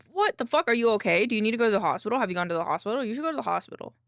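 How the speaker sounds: loud, urgent burst of questions; alarmed concern. Real, not acted. Reacting to a viewer who lost hearing, loudly alarmed. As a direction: fire off worried questions, loudly.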